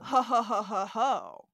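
ho ho ho with a laugh; playful ho-ho-ho; mock jolly laugh. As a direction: chuckle like a teasing Santa. Mock Santa laugh at question.

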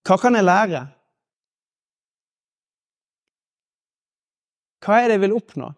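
calm, measured questions with long pauses. Acted. Speaker guiding the audience through constructive questions, calm and unhurried. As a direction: let each hopeful question settle. Leads an exercise of positive questions.

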